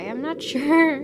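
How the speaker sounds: laughing through it. Real, not acted. Out-of-character chat, laughing.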